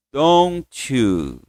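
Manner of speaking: "Don't you" joined into "donchu"; linked don't you. Shown as a demonstration. Teacher links the words for the class, joined smoothly. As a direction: run "Don't you" together, the t melting into the you. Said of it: "Liga, ó".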